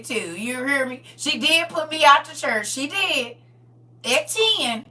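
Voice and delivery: loud, high, fervent preaching; preaching at full force. Acted. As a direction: preach it out to the congregation.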